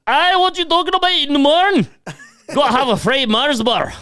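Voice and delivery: very high, loud hammed-up thick Scottish accent; mock Glasgow accent. Mocking a Glasgow local in a high, loud thick Scottish accent. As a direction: bellow it like a rowdy Glaswegian. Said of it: "and they'd be like".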